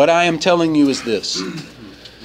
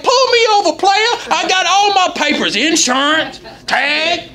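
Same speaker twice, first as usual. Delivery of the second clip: cocky taunt. Retold or imitated. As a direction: taunt the cops like you're untouchable. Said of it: "I'm like".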